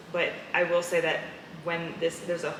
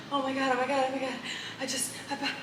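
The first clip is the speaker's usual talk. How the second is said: a touch of imitated panic, higher, stammering; retold panic; 8 semitones higher, faster than usual. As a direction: replay the frightened wake-up, lightly. Retold waking boyfriend in panic.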